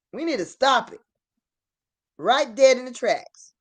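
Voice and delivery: emphatic high outburst; angry demand. Real, not acted. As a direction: shut it down, now.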